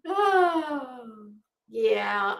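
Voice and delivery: normal talking voice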